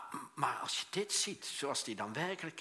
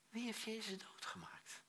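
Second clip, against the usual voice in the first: hushed rhetorical question; 9 semitones higher, 9 dB quieter than usual. Shown as a demonstration. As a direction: let the question hang in a whisper.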